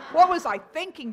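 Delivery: loud laughing outburst; laughing self-mockery. Real, not acted. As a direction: laugh at your own blunder. Laughing at herself.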